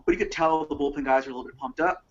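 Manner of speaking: ordinary delivery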